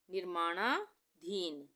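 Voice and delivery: निर्माणाधीन slow, split as निर्माणा, धीन; word read in two parts. Shown as a demonstration. Teacher dictating a long word, निर्माणाधीन in slow halves. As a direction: stretch निर्माणा, pause, then धीन. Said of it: "हम पढ़ सकते हैं निर्माणा".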